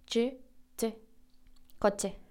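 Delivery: "coche" with a sharp, quiet tch; hard TCH. Shown as a demonstration. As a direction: click a T into "coche", softly. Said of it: "como si tuviera una T antes".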